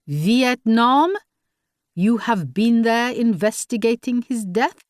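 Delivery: put-on lightly French-accented, dismissive official; a hint of a curt French commissioner. A narrator's reading. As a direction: brush her off in a French accent. Commissioner sceptical question.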